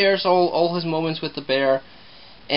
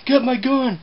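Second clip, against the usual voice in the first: higher, urgent mock-alarmed dad; 8 semitones higher than usual. Dad reacting to the living bear, mock-alarmed order. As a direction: bark it like a startled father grabbing a weapon. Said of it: "And the dad's like".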